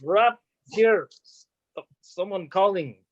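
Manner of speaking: thick mock Russian accent; hammy Russian rapper. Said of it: "my mother Russia".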